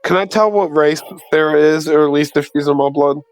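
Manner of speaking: ordinary talk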